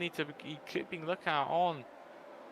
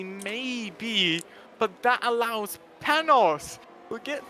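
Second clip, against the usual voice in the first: shocked excitement; 6 semitones higher than usual. Real, not acted. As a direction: react to the spin in alarm. Crash between Jazz and Trexie.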